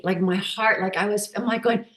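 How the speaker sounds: normal talking voice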